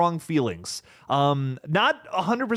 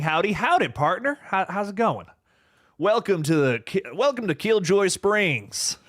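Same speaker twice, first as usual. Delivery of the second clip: fake cowboy accent; 6 semitones higher than usual. Reading cowboy-themed chat greeting.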